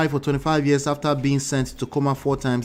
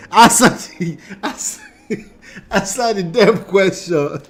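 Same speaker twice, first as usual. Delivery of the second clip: laughing demand; 7 semitones higher, 7 dB louder than usual. Pretend. Mock demand through laughter.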